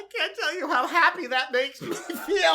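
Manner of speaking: gloating laugh. Retold or imitated. Maud gloating, voiced in retelling.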